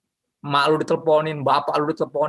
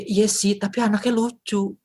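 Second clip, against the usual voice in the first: higher, sheepish Samson voice; lovesick excuse; 7 semitones higher, slower than usual. Acted. Samson excusing his girlfriend to friends, lightly sheepish. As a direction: shrug it off like a smitten guy.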